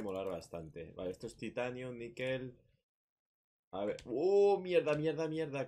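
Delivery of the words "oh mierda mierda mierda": high, loud startled curses; jolted by the attack; startled cursing. Real, not acted. Sudden crab attack.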